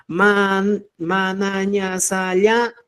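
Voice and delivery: "ma, na, ña, sa, ya" sounded out one by one; syllable drill. Shown as a demonstration. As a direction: spell out each syllable "ña" clearly. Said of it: "la pronunciación de los fonemas".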